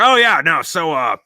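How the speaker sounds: loud, high swaggering pirate yarn; boastful old pirate. Acted. Old pirate bragging to the young sailor about his peg leg. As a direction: brag like an old sea dog. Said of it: "He's like".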